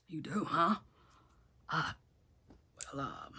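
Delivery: soft, hesitant, halting reply; deflated. Retold or imitated. Boaz hearing the relative wants the property, deflated. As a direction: trail off quietly, taken aback. Boaz taken aback, disappointed.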